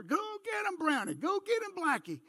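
high hunting holler urging the dogs on. Retold or imitated. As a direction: call out to the dogs across the woods. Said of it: "I heard my dad holler".

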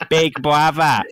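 put-on voice imitating the other player; mock big-brother greeting; mocking imitation. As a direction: mimic the botched line teasingly. Mocks co-player's earlier improvised line.

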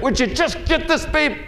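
high-pitched pleading; mother in labour. Retold or imitated. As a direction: beg high and urgent like a woman in labour. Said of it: "Very similar to this kind of expression".

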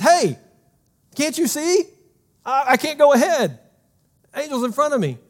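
talking donkey voice. Acted. Talking donkey in Bible story.

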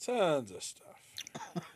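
slow, drawn-out whisper; teasing whisper. Acted. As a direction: hush it like a secret teaser. Teasing hint before his story.

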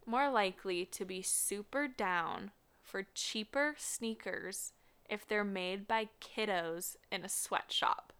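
normal talking voice

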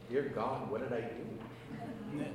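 hushed, slowing self-questioning; retold private alarm, kept under the breath; worried inner thought. Priest asking himself why the woman is weeping, quietly worried.

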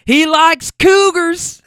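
loud, high, drawn-out mock shout; hammed-up whooping announcement. Rider announcing it to her friends, mock shout. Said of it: "One of 'em shouts".

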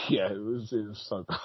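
real soft laugh through the words; helpless, hushed giggling as you speak; laughing agreement. Real, not acted. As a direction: agree while breaking up quietly. Laughing about bad accents.

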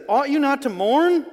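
high, loud and slow plea; pleading outcry. Retold or imitated. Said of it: "And then he says".

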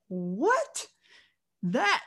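rising, incredulous question; taken aback, retold; disbelief. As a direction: stop short in disbelief, voice lifting. Retells his disbelief at the news.

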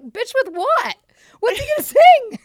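high, loud squawk of disbelief; mock incredulous. Friend scoffing at a one-hit wonder's encore, mock incredulous. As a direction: pitch way up, laughing at the absurdity.

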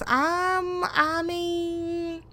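high, drawn-out mock hesitation; dramatic pause. Recapper imitating [name]'s answer to pregnancy rumors, dramatic stalling. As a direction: stall theatrically, holding each word. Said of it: "she did the dramatic pause".

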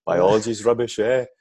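hammed-up Scottish schoolboy accent greeting the class. Boy introduces himself to new class in put-on Scottish accent. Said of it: "I spoke in a Scottish accent".

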